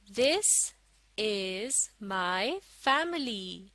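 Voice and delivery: very slow, word by word; slow model reading. Shown as a demonstration. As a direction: read it out slowly for learners to follow. Model reading for learners to follow. (धीरे-धीरे, एक-एक शब्द पढ़कर)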